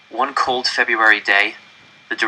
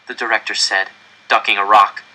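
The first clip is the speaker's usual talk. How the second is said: plain reading voice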